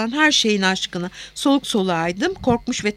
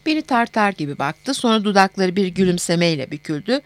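usual speaking voice

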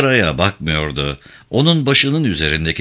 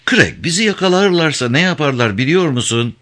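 high-pitched voice of alarm; shocked; 7 semitones higher than usual. A narrator's reading. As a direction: warn him urgently, shaken by shock. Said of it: "sesi duyduğu şoktan boğuk çıkıyordu".

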